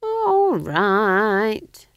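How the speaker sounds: high, drawn-out grudging consent; relenting. A narrator's reading. Old witch allows one candle, relenting. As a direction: give in a bit, loud and stretched. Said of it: "relenting a bit".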